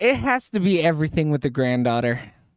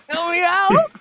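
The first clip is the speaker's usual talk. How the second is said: high, drawn-out mock wail; mock pleading; 12 semitones higher, slower than usual. Friend hams up a movie scene, wailing high.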